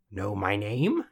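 croaky little-man challenge; croaked. A narrator's reading. Little man testing the girl, croaked. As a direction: croak it out as a taunting question. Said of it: "he croaked".

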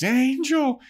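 high-pitched mock scream of a name. Character waking from a bad dream, high mock scream. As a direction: cry out as if waking, at a held-back volume. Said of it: "she wakes up and she screams".

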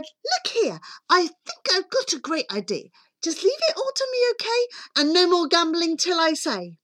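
a hint of a bossy young heroine. A narrator's reading. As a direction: pitch it up as a girl taking charge.

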